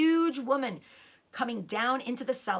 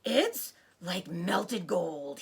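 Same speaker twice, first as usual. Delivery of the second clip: gasped awe; much slower than usual. Acted. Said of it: "he gasped".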